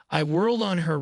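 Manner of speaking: ordinary delivery, nothing marked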